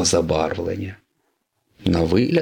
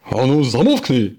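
raised, higher-pitched angry order; irritated yell; 5 semitones higher, 3 dB louder than usual. Acted. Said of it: "заверещав Кабуто". (роздратований вигук)

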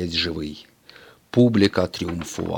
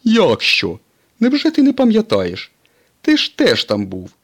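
much higher, louder incredulous voice; Mater answering; 9 dB louder than usual. A narrator's reading. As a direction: act amazed he forgot. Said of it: "відповів Сирник".

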